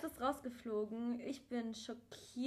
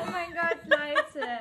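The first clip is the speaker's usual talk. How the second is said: much louder, laughing oh my god; excited laughing outburst; 5 semitones higher, 11 dB louder, slower than usual. Real, not acted. Cohost reacts to audience, loud laughing disbelief. Shocked laugh at podcast clip.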